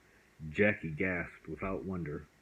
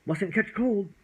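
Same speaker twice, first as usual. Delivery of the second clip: high, louder fussy dragon; fussing chatter; 12 semitones higher, 6 dB louder than usual. A narrator's reading. Dragon rushing the fragile living thing along, high and fussing. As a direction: hurry it along in a high flutter.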